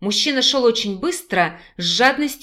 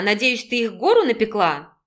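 ordinary delivery, nothing marked

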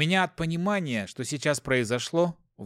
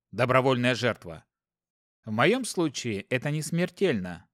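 ordinary delivery

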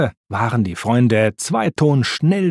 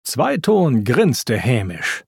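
ordinary delivery

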